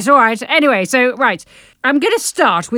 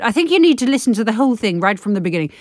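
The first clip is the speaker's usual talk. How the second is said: ordinary talk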